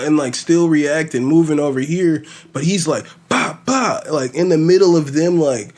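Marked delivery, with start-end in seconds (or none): 3.3-4.0 high sharp "bah bah" punch noises; punch sound effect. Retold or imitated. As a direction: pop out quick punch noises. Mimicking fast punches landing.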